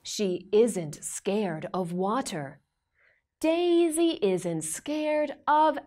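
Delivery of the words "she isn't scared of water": plain reading voice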